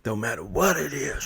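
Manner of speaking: ordinary delivery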